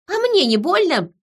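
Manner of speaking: high, sharp angry retort; hissed retort. A narrator's reading. Woman kicking her captor's knee, angrily hissing. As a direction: throw it back at them, pitched high. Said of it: "прошипела я".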